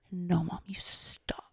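put-on soft, whispered toddler scolding; hushed kid voice saying stop; imitating toddler. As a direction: mimic a little boy telling mom off. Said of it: "he says it like that. He, like, mimics their tone".

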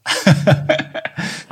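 breathy, unvoiced laughter; embarrassed laugh. Real, not acted. Co-host urged to try an American accent, embarrassed laugh. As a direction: laugh off the request to perform.

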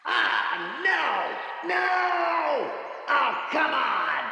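far-off echoing yells; yelling from far away; frustrated distant yells. Acted. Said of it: "frustrated yells echoing".

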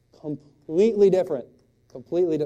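big emphatic punch on different; emphatic punchline. Shown as a demonstration. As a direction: land the contrast hard. Repeated punchline, hammered home.

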